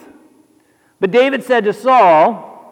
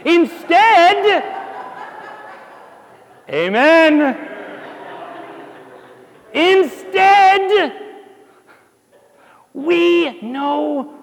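excited; 5 semitones higher, much slower than usual. Real, not acted. Preacher building to climax.

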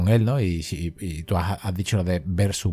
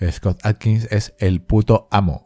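slow, weighted stress on puto; proud slow verdict, every word weighted; emphatic declaration; slower than usual. Real, not acted. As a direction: lean on "puto" and drag "amo" out slowly. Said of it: "pero tengo que decirlo".

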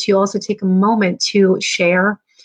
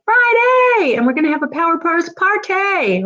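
party hype; 9 semitones higher than usual. Real, not acted. Host hyping viewers for Friday party, excited. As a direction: celebrate with pitch held up high.

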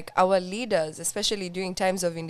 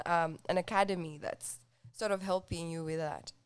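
usual speaking voice

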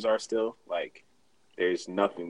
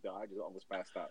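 much softer inner voice; 2 semitones lower, 15 dB quieter than usual. Retold or imitated. Rider quietly admits to himself, much softer. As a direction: murmur it to yourself in shock. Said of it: "deep down, I'm like".

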